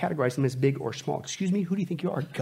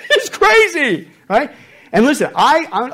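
high, loud exclaim; loud preaching; 10 semitones higher, 10 dB louder than usual. Real, not acted. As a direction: call it out to the room.

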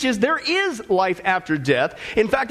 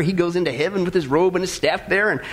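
ordinary talk, nothing marked

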